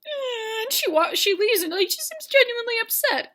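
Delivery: high, wailing tearful narration; mock crying. Teller describing the rejected woman leaving, mock crying. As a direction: wail it as if bawling.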